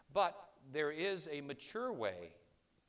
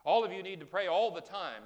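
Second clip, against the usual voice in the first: louder preaching call, imitating the old preacher; retold preacher's exhortation; 5 semitones higher, 10 dB louder than usual. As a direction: boom it out like the preacher he heard. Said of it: "and he said".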